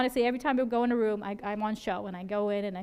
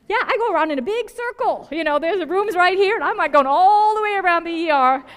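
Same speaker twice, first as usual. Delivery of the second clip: 1 excited; 8 semitones higher, 12 dB louder than usual. Real, not acted. Joking about dodging waiting patients.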